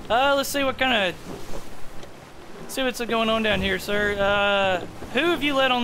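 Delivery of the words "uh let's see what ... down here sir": usual speaking voice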